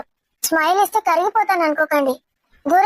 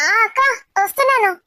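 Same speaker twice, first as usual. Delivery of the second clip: ordinary talk, nothing marked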